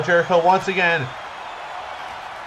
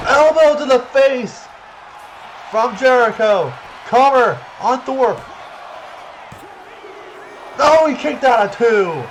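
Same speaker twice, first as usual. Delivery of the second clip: excited commentator; 7 semitones higher, slower than usual. Acted. Big move, near fall.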